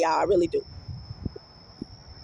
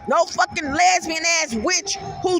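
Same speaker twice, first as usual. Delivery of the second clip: usual speaking voice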